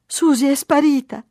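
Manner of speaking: put-on high, loud child's wail; acted tearful little girl; sobbing. A narrator's reading. Mary runs to papà about lost Susie, sobbing. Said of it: "singhiozzò".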